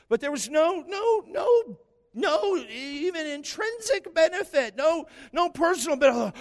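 loud, high-pitched indignant protest; exasperated. Real, not acted. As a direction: pile up each "no" high and insistent. Exasperated rant about celebrity visitor.

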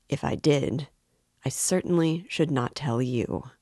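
ordinary delivery, nothing marked

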